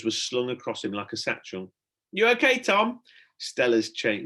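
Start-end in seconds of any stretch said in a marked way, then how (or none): 2.1-3.0 higher, louder, muffled call; torch in mouth. A narrator's reading. Stella to Tom, torch in her mouth, muffled call. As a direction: talk round a torch between the teeth. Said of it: "strange whisper, on account of the torch".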